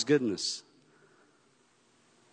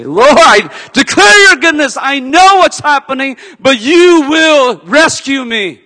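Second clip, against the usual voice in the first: loud, high, fervent proclamation; crazy declaration; 8 semitones higher, 7 dB louder than usual. Acted. Believer declaring God's goodness, fervent shout. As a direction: shout your faith like a crazy man.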